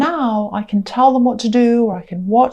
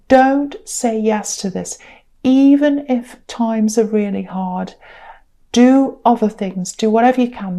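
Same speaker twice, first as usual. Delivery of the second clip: ordinary talk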